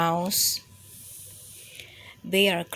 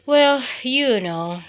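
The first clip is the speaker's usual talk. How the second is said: ordinary delivery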